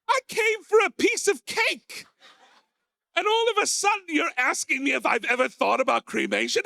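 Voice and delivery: put-on high, indignant old lady; Eve's outraged voice. Old Eve snapping at Margaret over tea, high and indignant. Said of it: "step into Eve's persona and go".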